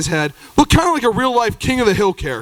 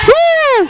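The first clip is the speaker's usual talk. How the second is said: high whoop; 7 dB louder than usual. Real, not acted. Crowd cheer exclamation.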